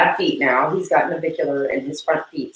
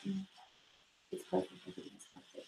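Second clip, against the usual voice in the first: fading to a breathy hush; an eight-year-old lost in bliss, whispering; dreamy hush; 27 dB quieter than usual. Retold or imitated. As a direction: savor it, almost silent. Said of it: "I'm like".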